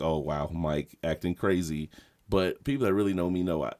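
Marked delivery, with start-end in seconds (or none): 0.0-1.9 judgy onlookers. Retold or imitated. Imagined people judging him.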